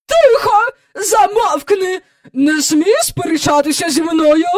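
high, loud sharp commands; furious silencing. A narrator's reading. Visitor silencing the arguing boy, furious scolding. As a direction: shut the child up imperiously.

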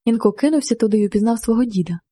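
ordinary delivery

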